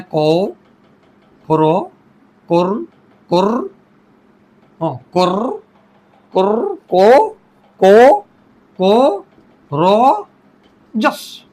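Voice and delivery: courageous sounded out as को, रो, जस with long gaps; syllable by syllable. Shown as a demonstration. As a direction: isolate each piece, को... रो... जस, repeating the first. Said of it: "आइए, इसके syllable हैं". (courageous का हर syllable अलग-अलग बोलें: को... रो... जस)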